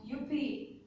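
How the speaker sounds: a restrained little whoop; mildly cried out. A narrator's reading. Storyteller voices a cheer at the fallen tree, mildly cried out. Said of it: "cried out".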